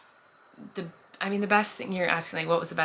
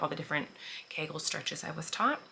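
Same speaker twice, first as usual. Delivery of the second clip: normal talking voice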